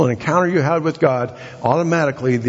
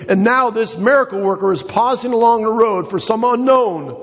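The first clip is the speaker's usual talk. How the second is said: preaching; 8 semitones higher than usual. Acted. Preaching, pitch raised.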